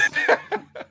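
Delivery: unvoiced high laughing burst; breathy laugh. Real, not acted. Laughs at co-host joke.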